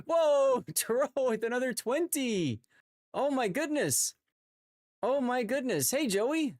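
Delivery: high, delighted exclamations; excited. Real, not acted. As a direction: gasp at the gifts with joy. Huge gift subs arrive.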